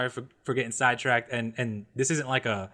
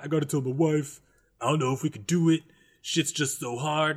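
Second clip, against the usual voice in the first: mock dramatic dad. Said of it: "he made everything so fucking dramatic".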